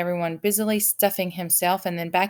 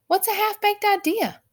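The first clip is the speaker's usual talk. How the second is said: put-on curious boy; 4 dB louder than usual. A narrator's reading. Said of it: "asked Milo again".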